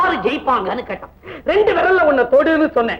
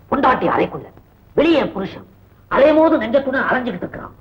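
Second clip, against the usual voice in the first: usual speaking voice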